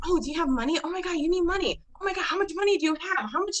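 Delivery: high, frantic mock worry; high-pitched anxious rush of questions; worrying inner voice. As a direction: act out a nagging, panicky ego. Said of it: "I'm always worrying".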